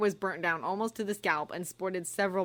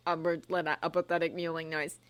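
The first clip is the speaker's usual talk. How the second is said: plain reading voice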